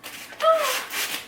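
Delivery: sharp high intake of breath in delight; delighted high gasp. Real, not acted. Unboxing a ghost cat figurine, a delighted high gasp. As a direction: gasp at the first sight of it.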